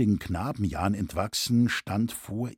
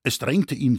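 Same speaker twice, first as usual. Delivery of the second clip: ordinary delivery, nothing marked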